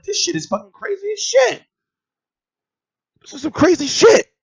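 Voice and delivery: the penny drops while editing; stunned realization. Retold or imitated. Editing the video at home, amazed and high. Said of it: "and I'm like".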